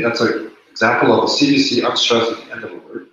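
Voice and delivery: normal talking voice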